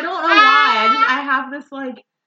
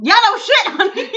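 sassy mock agreement; 12 semitones higher, 7 dB louder than usual. Real, not acted.

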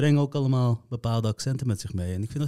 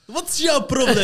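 much higher, loud outburst through laughter; mock angry father; 5 dB louder than usual. Friend imitates a father insulted at being treated as dumb, mock angry. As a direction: imitate an irate dad, laughing as you do. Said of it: "Dan wordt hij heel boos".

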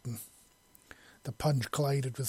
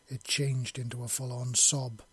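usual speaking voice

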